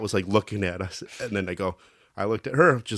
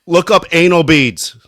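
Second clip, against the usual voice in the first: loud slow command; 6 semitones higher, 8 dB louder than usual. Retold or imitated. Prank command to someone's Siri.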